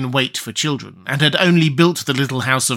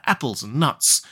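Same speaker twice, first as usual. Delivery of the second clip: plain reading voice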